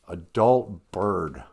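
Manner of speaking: ordinary talk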